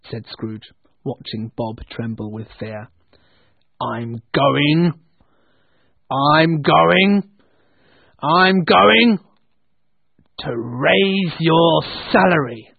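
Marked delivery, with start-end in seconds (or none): 3.8-12.7 mounting, booming fake threat; suspenseful build-up. A narrator's reading. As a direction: build menacingly, pausing between repeats, then stretch the announcement. Scrooge builds suspense before surprise announcement.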